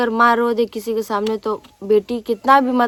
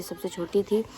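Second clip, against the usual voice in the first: soft unvoiced whisper; whispered aside; 2 semitones lower, 9 dB quieter than usual. Real, not acted. Aside about being the youngest. (धीमी फुसफुसाहट में)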